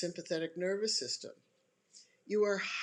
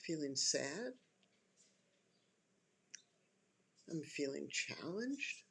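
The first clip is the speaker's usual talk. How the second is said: calming self-talk; 4 dB quieter than usual. Shown as a demonstration. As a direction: settle gently into each feeling, unhurried. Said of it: "what you'd experience in just listening to me".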